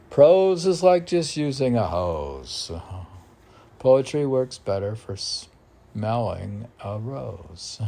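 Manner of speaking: declaimed rhyming verse; poem recitation. Acted. Poet reading his verse to the host, declaiming. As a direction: recite like a sermon.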